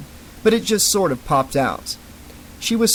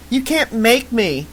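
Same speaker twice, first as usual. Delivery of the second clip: hollering; 8 semitones higher, slower than usual. Acted. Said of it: "she hollered".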